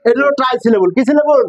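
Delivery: ordinary talk, nothing marked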